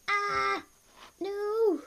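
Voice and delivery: high, loud yelp of dismay; sudden high alarmed no; startled cry. Real, not acted. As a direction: cry out at the mess. Hand in water mishap.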